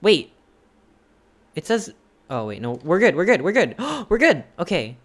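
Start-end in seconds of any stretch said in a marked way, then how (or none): 0.0-0.3 loud sudden stop; sharp shout. Real, not acted. Sudden alarm. 2.6-4.4 rising loud relief; relieved. Real, not acted. Relief after alarm.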